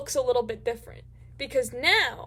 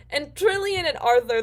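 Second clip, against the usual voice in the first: ordinary talk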